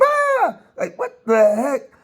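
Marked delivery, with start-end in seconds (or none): 0.0-0.6 high retold yelp; imitating her scream; mock scream. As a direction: echo her scream mockingly. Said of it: "you just scream".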